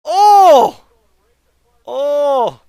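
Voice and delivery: sudden loud high cry; shocked shout. Real, not acted. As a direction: yell out in disbelief. Shocked at play.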